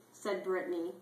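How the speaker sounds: ordinary talk, nothing marked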